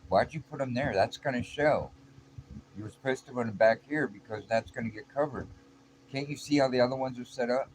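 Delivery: acted scolding. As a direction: scold a workmate for an obvious blunder. Coworker scolds over misplaced screws.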